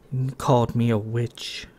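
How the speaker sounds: soft, hurt and hushed; softly. Acted. Sam softly tells Kay he hurt her. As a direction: speak softly, wounded. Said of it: "she said softly".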